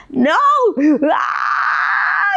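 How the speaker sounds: mock child's tearful scream of no; imitated screaming cry. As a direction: wail it out like a child beside herself. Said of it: "She's screaming and crying".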